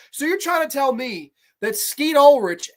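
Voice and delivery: ordinary talk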